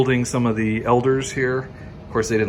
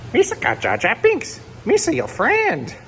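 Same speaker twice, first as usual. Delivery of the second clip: Jar Jar Binks; 13 semitones higher than usual. Retold or imitated. Jar Jar figure voiced.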